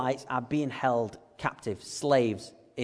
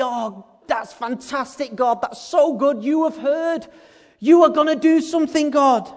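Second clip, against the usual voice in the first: mock-gushing Moses; 10 semitones higher, 8 dB louder than usual. Moses gushing sarcastically to God, high and hammed-up. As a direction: ham up Moses's sarcastic delight. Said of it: "I bet Moses at this point is thinking".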